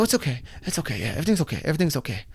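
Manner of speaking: put-on soft, reassuring to a child. Father calming his confused son, soft and reassuring. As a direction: gently soothe the boy.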